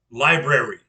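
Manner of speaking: Library said loud, every syllable crisp; corrective articulation. Shown as a demonstration. Grandfather correcting the grandkids, crisp and loud. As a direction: punch out Library clearly as a correction. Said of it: "and I'll correct them".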